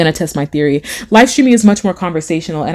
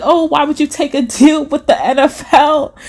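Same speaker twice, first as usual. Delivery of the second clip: laughing; 7 semitones higher than usual. Retold or imitated. Mock question about [name].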